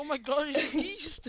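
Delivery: high teasing copy of a shocked cry; mocking imitation. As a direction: echo your friend in a silly high voice. Mimics friend's acted shocked quote.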